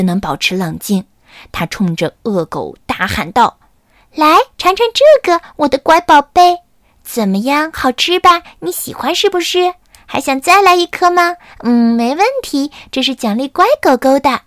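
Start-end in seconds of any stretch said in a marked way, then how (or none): 4.1-14.4 high, sing-song coaxing to a pet; coaxing a dog. Acted. Carmen coaxes the fierce dog with treats, high and cooing. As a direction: sweet-talk the dog like a puppy.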